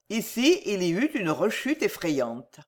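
plain reading voice

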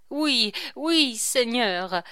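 high, loud frightened answer; teeth-chattering fear. A narrator's reading. Henri answers the voice with chattering teeth, mildly fearful. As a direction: answer the Lord in mild acted terror. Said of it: "dont les dents claquaient".

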